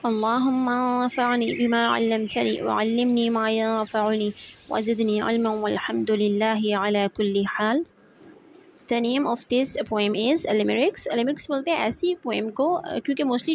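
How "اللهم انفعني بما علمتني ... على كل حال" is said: slow, measured Arabic prayer; prayer recitation. Acted. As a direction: recite the dua slowly and evenly. Recites an Arabic prayer before the lesson. (धीमे, ठहरे लहजे में दुआ पढ़ते हुए)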